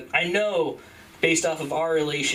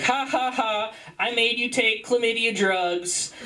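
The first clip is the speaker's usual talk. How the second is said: slow, high singsong gloating; imitated taunting; 7 semitones higher, slower than usual. Girlfriend making fun of him, high slow taunt. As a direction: tease like a mocking girlfriend. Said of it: "she just made fun of me".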